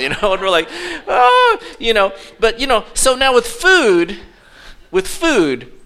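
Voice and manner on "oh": high, loud mock-exasperated "oh"; exasperated groan. Parents hearing son order chicken fingers, mock exasperated. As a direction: wail in playful parental despair.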